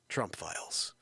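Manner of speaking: low hushed title reveal; dramatic whisper. Acted.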